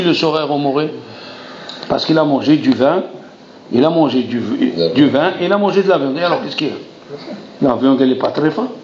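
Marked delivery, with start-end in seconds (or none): none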